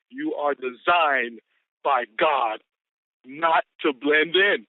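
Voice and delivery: slow, deliberate, each phrase spaced; slow solemn recitation. Shown as a demonstration. Speaker reciting the day's quote to the team, slow. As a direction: lay the quote down word by word.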